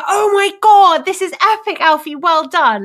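high, loud gush of congratulation; excited brotherly praise. Retold or imitated. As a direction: imitate a thrilled brother congratulating. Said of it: "this real kind of brotherly love".

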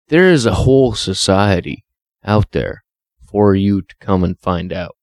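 ordinary talk

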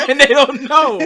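high, loud words through laughter; laughing. Real, not acted. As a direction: laugh out the punchline. Laughing at own joke.

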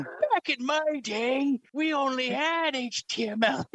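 put-on slow, high creaky old-timer; old elderly voice. Shown as a demonstration. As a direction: grumble it like a cranky grandpa. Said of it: "an old elderly voice".

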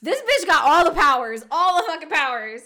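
loud, amazed rant; excited outburst. Real, not acted. Host marveling at Feyre's powers, loudly excited. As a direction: shout in delight.

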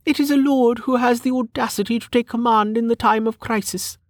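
high-pitched, haughty lady; high, mannered noblewoman voice; sniffy noblewoman. Acted. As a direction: lecture them like a sniffy aristocrat. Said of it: "she sniffed".

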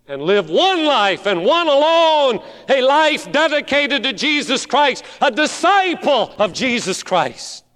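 high, loud rising appeal; fervent preacher; preaching build. Acted. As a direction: drive it home from the pulpit.

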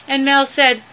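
plain reading voice

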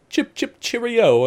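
high, drawn-out fake British accent; slow, squeaky British send-up; imitated mock British. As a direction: mimic a friend's silly British catchphrase. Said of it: "He's like".

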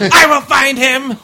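very high, slow, loud yell; mock villain shout. Repeating Zod's line over laughter, slow high mock shout. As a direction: stretch it into a hammed-up roar. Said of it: "He said".

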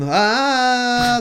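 high, loud, long "Ah"; mock scream. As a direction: scream to scare the monsters off. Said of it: "they have to scream".

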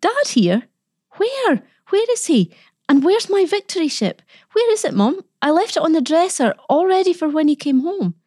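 put-on very high, breathless boyish questions; wild excited yelp. A narrator's reading. Boy hearing his dad is home, wildly excited. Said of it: "Reg gave a wild yelp".

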